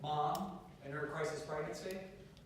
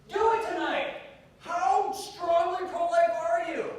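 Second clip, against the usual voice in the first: loud, high, drawn-out rallying cry; impassioned high-pitched exhortation; full-force preaching; 12 semitones higher, 9 dB louder than usual. Real, not acted. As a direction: shout it to rouse the whole crowd.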